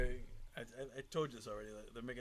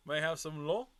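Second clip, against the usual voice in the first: mock French; 3 semitones higher, 8 dB louder than usual. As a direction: show off schoolbook French, a bit louder. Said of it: "I know a little bit of French".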